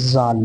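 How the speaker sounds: জাল with a slightly thick sound; thickened জাল. Shown as a demonstration. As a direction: make জাল a little heavy. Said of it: "উচ্চারণটা একটু মোটা হবে".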